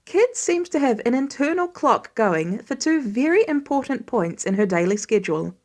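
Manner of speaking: ordinary delivery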